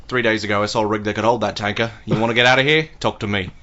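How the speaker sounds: put-on low Australian accent, laconic movie quote; Mad Max. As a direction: do a terse Australian road-movie hero. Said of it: "you just remember Mad Max".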